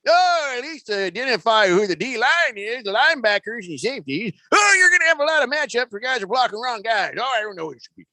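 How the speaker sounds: put-on high, fretful old codger; complaining old man. Host putting a voice on the quarterback's complaint, old man voice. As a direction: fret like a caricatured old man. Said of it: "I just threw the voice on it".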